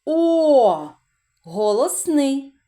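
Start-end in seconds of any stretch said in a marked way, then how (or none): 0.1-0.9 О said loud and drawn out; isolated vowel. Shown as a demonstration. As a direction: sound out О clearly. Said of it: "Єно".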